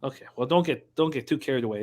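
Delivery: usual speaking voice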